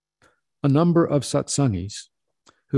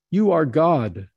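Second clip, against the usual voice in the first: slow, higher, louder plea; begging; 6 semitones higher, 4 dB louder, slower than usual. A narrator's reading. Drunk peasant at the master's feet, begging slow and loud. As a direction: beg him with all you have, slowly. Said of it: "he begged".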